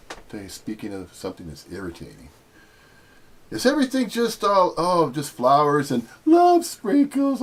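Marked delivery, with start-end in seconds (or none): 3.5-7.4 mocking sweetness.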